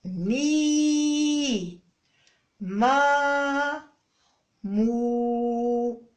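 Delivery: long held مي, ما, مو in turn; drawn-out syllables. Shown as a demonstration. Mother rereading the cards for the child, drawn out. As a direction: read each syllable slowly and held. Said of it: "عاود اقراهم".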